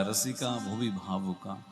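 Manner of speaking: ordinary talk, nothing marked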